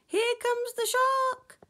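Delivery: high, loud shriek of alarm; loud, very high-pitched panic; panicked cry. Acted. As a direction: shriek it as a floundering fish. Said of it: "The flounders were floundering".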